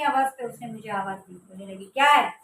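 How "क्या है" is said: loud snapping yell; angry shout. Retold or imitated. Sister yelling back from the dark, angry shout. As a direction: bark it back, irritated. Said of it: "ऐसे चिल्लाकर".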